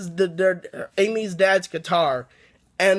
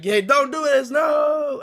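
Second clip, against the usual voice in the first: panicked yell; 7 semitones higher, slower than usual. Retold or imitated. Dummy yelling at Amy as he falls into the sewer, panicked high shout. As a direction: pitch climbing into a high shout on no.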